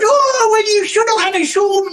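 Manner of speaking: very high mock female voice; exaggerated high-pitched whinge; imitated complaining woman. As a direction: mimic her moaning, sliding into blahs. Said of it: "I think that's how she talks".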